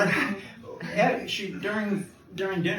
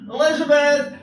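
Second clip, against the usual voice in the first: much higher, loud call across the suite; 10 dB louder than usual. Retold or imitated. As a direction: bellow her name over his shoulder. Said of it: "he leaned over his shoulder and goes".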